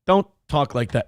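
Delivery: normal talking voice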